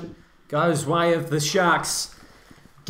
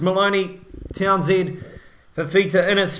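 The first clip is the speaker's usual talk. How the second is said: usual speaking voice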